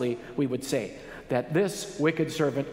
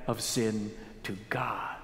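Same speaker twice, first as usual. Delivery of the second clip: hushed, breathy whisper; 8 semitones lower, 8 dB quieter than usual. Real, not acted. Preacher asks the congregation softly, whispered. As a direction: drop to a whisper on the key question.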